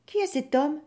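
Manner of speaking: high, anxious question; young woman's lightly alarmed voice; worried. A narrator's reading. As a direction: ask uneasily about the stranger. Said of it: "s'inquiète Marie".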